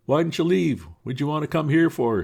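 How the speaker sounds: high, loud demanding question; accusing voice. Acted. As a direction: challenge Potter from the crowd. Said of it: "somebody said".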